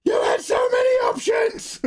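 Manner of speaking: very high-pitched screech of protest; squealed, exasperated shout; mock outburst. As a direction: cry out in mock outrage, way up high. Mock outburst after the accent bit.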